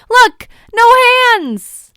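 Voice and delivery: loud high call, dropping on hands; gleeful shout. A narrator's reading. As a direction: show off while tumbling. Rolling down the hill.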